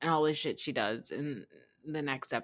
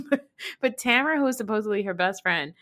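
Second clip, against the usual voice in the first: usual speaking voice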